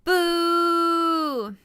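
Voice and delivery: loud, high sung shout; mock song yell. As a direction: belt it like a rock singer. Mimicking the singer.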